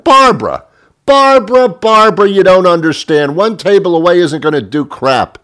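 very high, loud exasperated squawk; exasperated protest. Acted. Diner telling waitress one table away won't help, exasperated. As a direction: cry her name in mock desperation.

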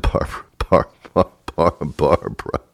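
unvoiced, stammering breathy repeat of Barbara; breathy flirt. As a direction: swoon over the name Barbara. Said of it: "I said".

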